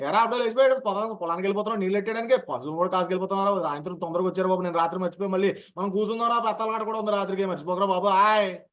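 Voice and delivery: fast East Godavari dialect. Shown as a demonstration. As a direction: rattle it off like an East Godavari farmer. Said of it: "ఒక వేగాన్ని నేను ప్రయత్నించి మీకు చూపిస్తాను". (తూర్పు గోదావరి యాసలో చాలా వేగంగా)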